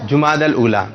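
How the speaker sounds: "جماد الاولا" as jumaadal-oola; correct pronunciation. Shown as a demonstration. Host confirming for the audience, "جماد الاولا" pronounced jumaadal-oola. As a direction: model "جماد الاولا" with short u in ju- and -dal. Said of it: "درست تلفظ کیا ہے".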